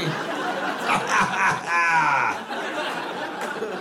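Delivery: long hammed-up ha-ha-ha; mock self-pleased laugh. Joker amused by own pee joke, long fake laugh. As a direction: imitate someone roaring at their own joke.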